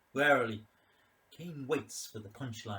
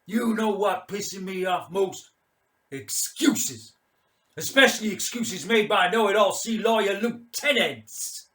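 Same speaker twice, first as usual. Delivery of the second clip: loud, higher sneering officer's rant; drunken bullying commander; 9 semitones higher, 12 dB louder than usual. Acted. Mad Mike mocking the lieutenant's excuses, loud sneering rant. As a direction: rip into him, words dripping scorn.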